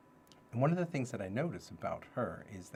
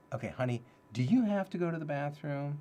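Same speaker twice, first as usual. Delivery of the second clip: higher, louder sing-song parent voice; talking to toddler; 6 semitones higher, 5 dB louder than usual. Retold or imitated. New dad asking his toddler daughter, higher sing-song voice. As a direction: coax a little girl gently. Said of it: "it's like".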